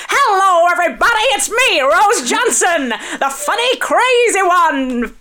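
high, loud, showy announcer intro; bright old-Hollywood starlet voice; film opening line. Pretend. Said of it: "as if it's the first line of a film".

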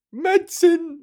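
a high, loud sung-out medicine; mock Mary Poppins. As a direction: hit it high like a musical nanny. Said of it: "Who am I, Mary Poppins?".

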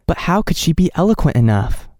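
ordinary delivery, nothing marked

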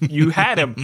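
laughing, loud mock-gangster line; laughing gangster quip. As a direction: crack up while saying it. Mock-mafia joke, laughing.

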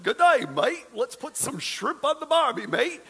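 high-pitched, hammed-up Australian accent. Shown as a demonstration. Husband embarrassing his wife at the kangaroos, Australian accent. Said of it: "go into my Australian accent".

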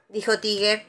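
ordinary delivery

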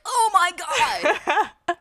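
slow, high gasping "oh my God"; shocked gasp. Retold or imitated. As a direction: gasp it out, stretched and laughing. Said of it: "he'll be like".

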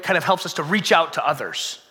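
usual speaking voice

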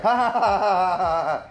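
high, forced ha-ha-ha; awkward fake laugh. Imitating the co-host's nervous reaction, awkward fake laugh. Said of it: "do an awkward, like, laugh".

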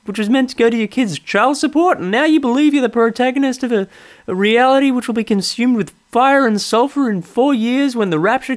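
mock Seinfeld's cadence; hammed-up Seinfeld parody; Jerry Seinfeld impression. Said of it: "my pseudo Jerry".

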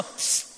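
one soft hissed "psst"; quiet wordless summons; psst attention call. Shown as a demonstration. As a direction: hiss softly to get someone's attention.

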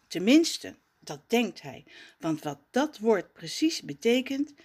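ordinary delivery

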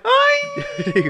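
put-on the kid's cry, with ¡Ay! jumping very high; mock the boy from the clip, ending in a squeal; parroting the boy's yelp from the video; imitating the kid. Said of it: "el niño me hace mucha gracia".

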